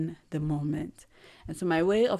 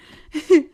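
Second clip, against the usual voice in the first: sudden high loud laugh; loud burst of laughter; 11 semitones higher, 14 dB louder than usual. Real, not acted. Laughing at her own accent slip, loud laugh.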